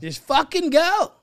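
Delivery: high, loud, slow mock yell; mock angry shout.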